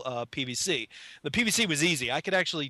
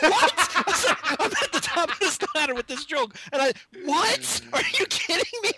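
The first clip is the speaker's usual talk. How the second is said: much higher, louder, laughing panic; panicked shock; 12 semitones higher, 4 dB louder than usual. Retold or imitated. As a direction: yelp out in horrified disbelief. Shocked at decapitation danger.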